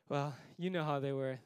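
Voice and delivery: usual speaking voice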